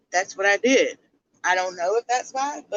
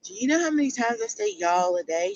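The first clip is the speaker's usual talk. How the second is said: ordinary delivery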